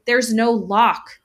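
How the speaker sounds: usual speaking voice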